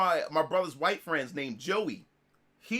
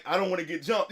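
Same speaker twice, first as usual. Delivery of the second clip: normal talking voice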